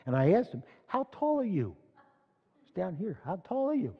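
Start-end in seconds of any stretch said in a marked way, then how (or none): 0.9-1.8 high-pitched, softish question aimed upward; small voice calling up. Acted. Calling up to a giant from below. 3.3-3.9 high-pitched, softish question aimed upward; small voice calling up. Acted. Repeats question to the giant above.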